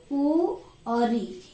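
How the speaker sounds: उ and री recited slowly one by one; teacher sounding out उ and री for the class; drawn-out recital of उ and री; short vowels recited. Shown as a demonstration. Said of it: "ये तीन आते हैं ह्रस्व स्वर में".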